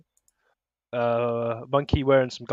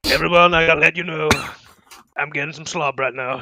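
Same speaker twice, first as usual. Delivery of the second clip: louder, fast announcement voice; a hint of a president on air; presidential announcement; 3 semitones higher, 5 dB louder than usual. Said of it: "a public service announcement".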